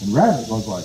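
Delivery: ordinary delivery, nothing marked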